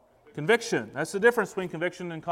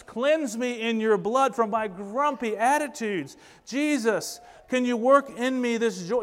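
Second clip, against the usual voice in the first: higher-pitched, pleading prayer; acted-out heartfelt prayer, raised in pitch; earnest prayer of faith; 5 semitones higher than usual. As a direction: plead with the Lord in repentant faith. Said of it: "Faith says".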